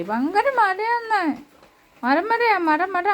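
very high, slow cooing; pet talk. Real, not acted. Owner cooing to her dog.

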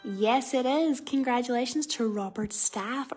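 loud winner reveal; excited announcement. Real, not acted. Winner announced over music.

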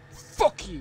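loud, high outburst; angry shout. Real, not acted. Streamer attacking a game character, angry shout. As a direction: hurl the curse out loud.

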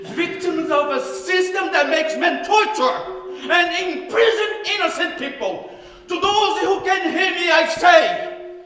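rising, building to a loud cry; ranting dictator speech. Acted. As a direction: voice swelling with urgency. Impassioned speech to the world.

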